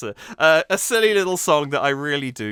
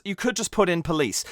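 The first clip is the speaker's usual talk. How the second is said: normal talking voice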